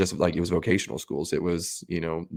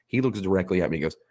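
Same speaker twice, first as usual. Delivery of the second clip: normal talking voice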